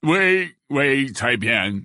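slow, drawn-out grudging assent; languid, bored acceptance; weary. A narrator's reading. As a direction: agree as if worn out. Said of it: "d'une voix lasse".

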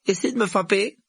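put-on high, loud boy's dare; plucky schoolboy squaring up; defiant challenge. A narrator's reading. Neville raises fists, challenging them.

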